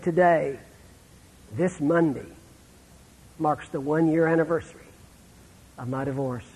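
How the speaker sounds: hushed, fading to almost nothing; retold whisper. Preacher quoting a sobbing man's divorce news, hushed. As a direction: quote the man's heartbroken words in a near-whisper. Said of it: "this is what he said in a whisper".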